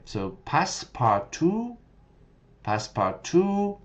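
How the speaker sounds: Passepartout said high, pass-par-too; setting up the demo. Shown as a demonstration. Teacher introducing the AI version. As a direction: present Passepartout brightly before the AI take. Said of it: "Listen to this".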